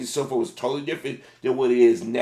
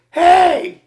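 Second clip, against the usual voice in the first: very high, loud yell at a sleeper; shouting wake up; 12 dB louder, much slower than usual. Retold or imitated. Male cashier yelling at sleeping driver, loud high shout. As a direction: bellow to jolt the driver awake. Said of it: "really, they're like".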